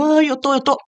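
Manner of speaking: high mock whimper; hammed-up self-pitying wail; imitated crying. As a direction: put on a tearful moan. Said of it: "Se você ficar sofrendo e chorando".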